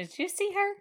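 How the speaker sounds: high-pitched Southern lady imitation; bad Southern accent. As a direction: gossip in a put-on Southern accent. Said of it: "this lady has a southern accent".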